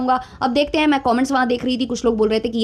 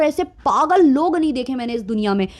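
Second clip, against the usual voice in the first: ordinary talk, nothing marked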